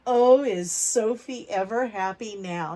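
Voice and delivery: ordinary delivery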